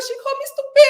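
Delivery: high, loud mock accent; Mike. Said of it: "Mike in this accent".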